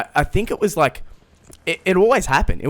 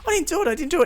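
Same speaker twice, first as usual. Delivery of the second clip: much higher, insistent denial; defensive protest; much faster than usual. Retold or imitated. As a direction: mimic her high defensive denial. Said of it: "she was so defensive".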